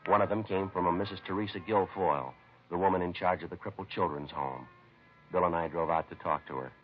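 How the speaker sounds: measured, matter-of-fact detective narration over music; steady procedural voice-over telling the investigation; crime story narration. A narrator's reading. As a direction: report the case like a tired police sergeant. Police narrator recounts the case.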